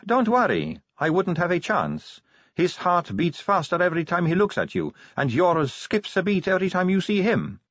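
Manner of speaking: high voice, frankly reassuring a rival; Sally, candid vampire. Acted.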